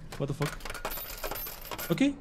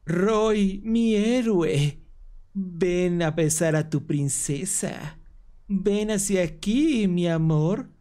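seductive princess; 3 semitones higher, slower than usual. Acted. Jen calling Roy her hero for a kiss, slow mock-sweet. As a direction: draw it out like a syrupy princess.

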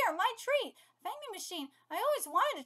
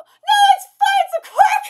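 high, very loud squealed protest; hysterical, high-pitched outburst; laughing shout; 9 semitones higher, 16 dB louder than usual. Real, not acted. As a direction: shout it shrieking with amusement. Exclaiming over the absurd scene.

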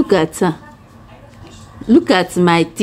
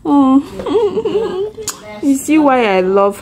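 high emotional laugh, near tears; tearful laugh; 8 semitones higher than usual. Real, not acted. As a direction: laugh while welling up. Mother moved by child.

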